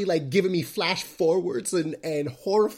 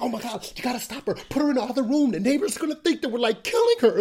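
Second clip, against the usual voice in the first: higher-pitched, hurried parental alarm; mock panic; 7 semitones higher, faster than usual. Parents fretting the neighbours will hear, in mock panic. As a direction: act out frantic parents, pitch climbing.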